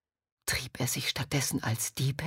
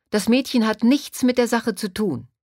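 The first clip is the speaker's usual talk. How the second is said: louder, higher protest; called-out interjection; 6 semitones higher, 5 dB louder than usual. A narrator's reading. Tang Ming cutting in to defend Han Li, calling out. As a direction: burst in to defend someone. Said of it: "rief plötzlich Tang Ming dazwischen".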